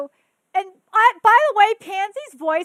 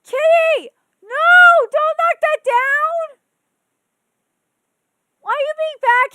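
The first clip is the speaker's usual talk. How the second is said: high, loud scolding of the cat; real shout at cat; 11 semitones higher, 13 dB louder than usual. Real, not acted. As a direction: stop the cat from knocking it down, shouting. Scolding cat knocking water over.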